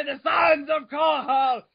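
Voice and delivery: overacted trailer shout; mock epic shout. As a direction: bellow it like a war cry. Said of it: "Can't do it like that".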